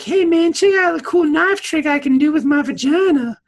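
high, loud show-off voice; cocky young woman. Retold or imitated. Dukat's daughter showing off her knife trick, high and loud. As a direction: brag it like a reckless teenager. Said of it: "she's all like".

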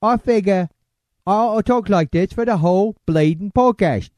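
hammed-up Cockney greeting; Cockney accent. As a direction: put on a cartoonish Cockney welcome. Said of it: "when I'm doing my Cockney accent".

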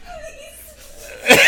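high, laughing mock plea squeezed out; imitating a desperate beg for mercy; mock begging plea. Friend begging staff to open the door, high laughing plea. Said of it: "He's like".

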